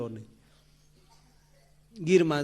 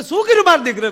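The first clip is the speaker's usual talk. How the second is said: put-on old mother-in-law; 14 semitones higher, 8 dB louder, faster than usual. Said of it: "એને સાસુ કે".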